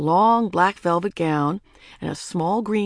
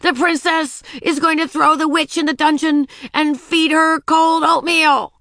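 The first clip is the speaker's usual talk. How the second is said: high, loud counter-threat, slowing at the end; defiant princess; 9 semitones higher, 7 dB louder than usual. A narrator's reading. Princess threatening the witch back, loud defiant cry. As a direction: proclaim it loudly as a child princess.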